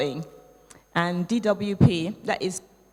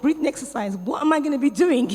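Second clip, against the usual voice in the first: higher, louder, laughing in disbelief; incredulous laughing; 7 semitones higher, 5 dB louder than usual. Retold or imitated. Recalling doubting her coach's breathing exercises, laughing disbelief. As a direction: scoff at the idea with a laugh.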